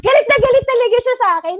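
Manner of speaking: loud, high laughing shout; laughing loudly. Real, not acted. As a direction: blurt it out cracking up. Laughing while retelling angry driver. (malakas na tawa habang nagkukuwento)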